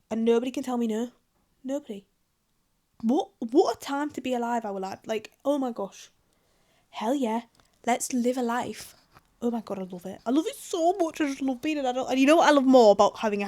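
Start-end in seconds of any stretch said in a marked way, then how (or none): none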